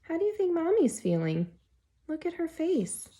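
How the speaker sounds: plain reading voice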